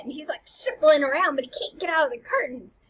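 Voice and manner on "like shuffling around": ordinary talk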